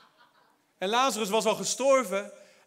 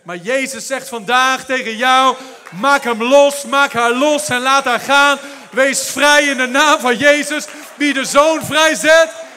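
loud, slow preaching shout; full-force preaching; 3 semitones higher, 13 dB louder, slower than usual. Real, not acted. Preacher proclaiming freedom to the congregation, full-force shout.

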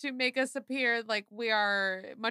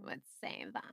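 vocal fry; 13 semitones lower, 9 dB quieter, faster than usual. Shown as a demonstration. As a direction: drop into a lazy fry. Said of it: "You did".

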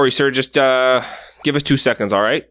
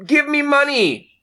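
grouse at the juggler like a fed-up shopper; grumpy customer; 14 semitones higher than usual. Acted. Customer snapping at a juggling busker, high put-on voice.

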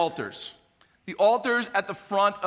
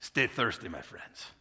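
put-on Spanish accent; 5 semitones lower, 8 dB quieter than usual. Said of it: "with his Spanish accent".

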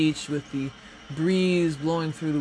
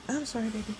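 higher, softer tender voice to a pet; soft cat talk; 4 semitones higher, 13 dB quieter, faster than usual. Real, not acted. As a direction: coo gently to the cat. Talking softly to her cat.

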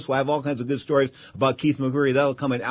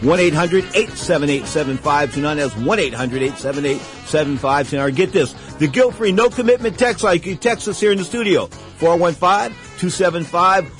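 radio announcer; 5 semitones higher than usual. Acted. Radio host opening the show.

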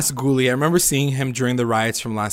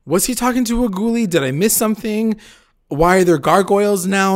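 confused; 7 semitones higher than usual. Retold or imitated. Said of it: "'cause I'm like".

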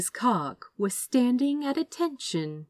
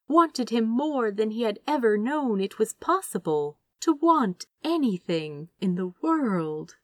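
ordinary delivery

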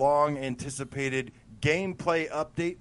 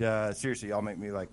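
ordinary talk, nothing marked

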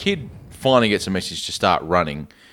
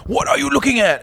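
mock henchman. Henchman challenging the kid, high sneering demand. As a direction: snap it like a thug. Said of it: "one of the henchmen says".

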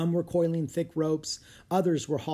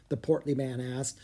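usual speaking voice

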